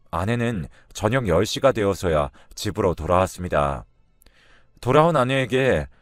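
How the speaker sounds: plain reading voice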